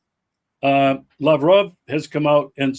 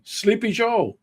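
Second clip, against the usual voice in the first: a light, higher, softer sneer at the name; mocking nickname; 5 semitones higher, 4 dB quieter than usual. Host mocking Trump's nickname for Biden, lightly sneering. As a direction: toss off the nickname with a mocking lilt.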